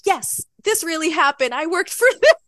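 proud and thrilled; excited triumph. Retold or imitated. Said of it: "And I'm like".

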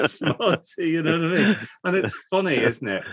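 high, loud, laughing through the punchline; real laughter bubbling through the words. Real, not acted. Interviewer finishing a sergeant major story for a colleague, laughing.

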